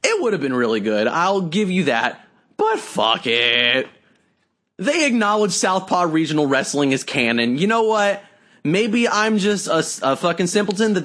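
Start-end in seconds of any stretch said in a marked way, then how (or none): none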